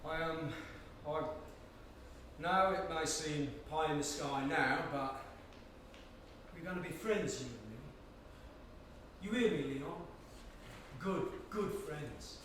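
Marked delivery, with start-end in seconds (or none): 2.9-12.4 soft, slow creepy promise; half-whisper, unsettlingly sweet; quiet menace. Acted. Frank creepily promises friendship.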